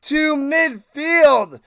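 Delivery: big booming sportscaster call, loud and high; loud, high stadium-announcer play-by-play of a video game kickoff; hyped play-by-play. Acted.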